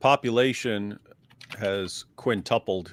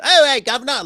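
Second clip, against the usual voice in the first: put-on much higher, louder cockney accent; mock dumb British accent; 13 semitones higher, 7 dB louder than usual. Childhood memory of dumb-sounding British accents. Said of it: "these guys sound like morons".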